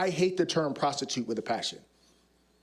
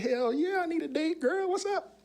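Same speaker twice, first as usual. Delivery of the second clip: very high, hammed-up eager reply; eager acceptance; 13 semitones higher than usual. Undercover officer accepting a date, hammed-up eagerness. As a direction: jump at the offer, voice climbing way up.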